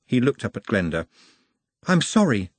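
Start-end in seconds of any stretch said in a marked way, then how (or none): 1.9-2.5 mild, higher-pitched apology; apologetic Nut. Acted. Nut looks up at Glenda, apologetic. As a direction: apologise earnestly to a friend.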